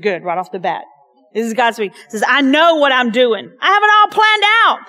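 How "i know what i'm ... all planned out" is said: hammed-up Southern God voice; playful Southern twang. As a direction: lean into a folksy Southern twang. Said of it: "I don't know if he's kinda having, like, this so Southern twang".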